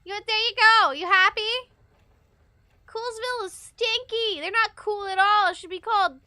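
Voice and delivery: loud defiant shout. Real, not acted. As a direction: yell the insult for all to hear. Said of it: "Yeah, I said it!".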